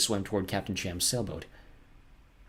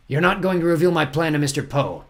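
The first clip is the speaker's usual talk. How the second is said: higher, louder threatening villain; menacing villain captain; 7 semitones higher, 6 dB louder than usual. Acted. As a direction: loom over your victim as you speak.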